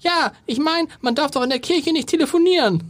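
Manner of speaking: put-on very high, prim young daughter; little girl voice. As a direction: squeak it like a bossy girl. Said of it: "Ich bin die Tochter".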